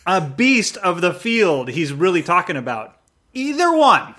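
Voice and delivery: loud, high, drawn-out build; emphatic mock reading. Podcaster playing up Jesus's blunter examples, loudly emphatic. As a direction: punch out each example, climbing in pitch.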